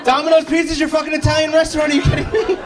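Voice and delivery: furious disbelief at the venue guy; exasperated rant. Retold or imitated. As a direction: blow up at the guy on the line. Frustrated call to the venue.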